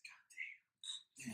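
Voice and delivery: breathed out under the breath; awed, barely voiced; stunned whisper. Reacting to the locals' welcome in a stunned whisper.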